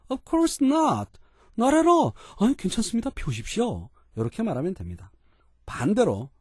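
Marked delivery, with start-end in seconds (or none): 0.1-3.9 eager polite reply. Acted. Visitor eagerly assures the boss that smoking is fine. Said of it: "라는 정도의 느낌일 때는".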